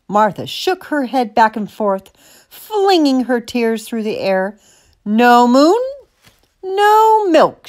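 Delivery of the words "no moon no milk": high, loud protest; indignant cow's bellow. A narrator's reading. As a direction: bellow it, stretching each NO. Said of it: "she bellowed".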